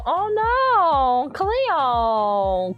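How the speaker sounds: drawn-out mock Australian wail; high, stretched Aussie cry sliding down; Australian accent. Streamer showing how mermaid characters would always go, exaggerated Australian accent. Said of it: "they would always go".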